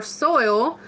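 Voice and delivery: "soil" with a full OY glide; careful standard pronunciation. Shown as a demonstration. Streamer repeating her correction, carefully pronounced soil. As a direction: lean on the oy in "soil". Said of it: "I can't naturally say soil".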